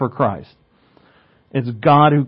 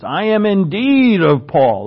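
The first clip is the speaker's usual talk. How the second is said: "indeed" leaned on, slower and a bit louder; stress on indeed; 5 semitones higher, 4 dB louder, slower than usual. Shown as a demonstration. Preacher showing the congregation the Greek emphasis, stress on "indeed". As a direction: dig into "indeed" like he's sticking to it. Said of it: "Like he's sticking to it, loves it".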